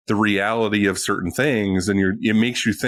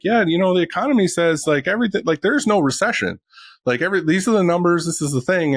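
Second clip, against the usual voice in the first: mocking economist voice, pitched up and breezy; 8 semitones higher than usual. Guest mocks the cheery economist, raised mocking tone. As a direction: sends up the upbeat economist for his podcast cohosts. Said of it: "he's like".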